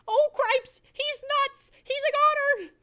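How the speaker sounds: very high, loud squeal of alarm; a frantic mouse screaming the news; panicked shout. Acted. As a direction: shriek it like the sky is falling. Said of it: "shouted Furlow again".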